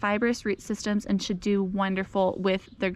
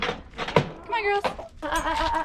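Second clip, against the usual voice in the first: calling the hens; 7 semitones higher than usual. Real, not acted. Calling chickens into run.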